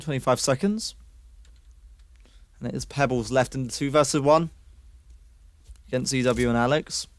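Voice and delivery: fast, lively esports commentary; excited caster calling a round; play-by-play casting. Acted. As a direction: call the action as it happens. Casting the retake.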